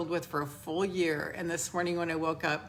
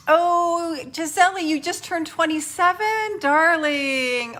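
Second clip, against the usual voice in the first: much higher and louder, gushing; delighted greeting; 9 semitones higher, 8 dB louder, slower than usual. Real, not acted. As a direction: burst out with delight at her birthday. Greets viewer on birthday.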